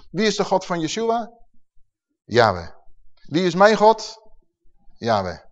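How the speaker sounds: ordinary talk, nothing marked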